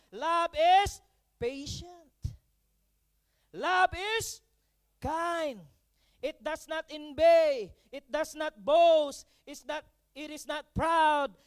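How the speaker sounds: slow, loud, swelling recital of the love passage; scripture recital. Acted. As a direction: preach it line by line, building.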